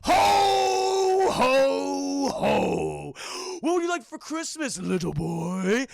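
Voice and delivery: hammed-up Santa, loud with a slow ending. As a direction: play the mall Santa asking the boy's wish. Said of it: "He's just going".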